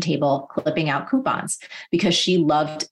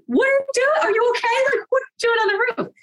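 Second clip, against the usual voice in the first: very high, incredulous retold shout; imitating a panicked kid, high-pitched; alarmed calling out. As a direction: call up in disbelief, pitched way up. Alarmed child calls to roof.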